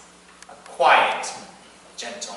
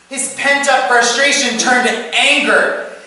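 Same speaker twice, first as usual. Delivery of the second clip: much higher, louder storytelling; heated climactic narration; 12 semitones higher, 8 dB louder than usual. A narrator's reading. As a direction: let the telling surge as the anger erupts. Story climax, father's anger erupts.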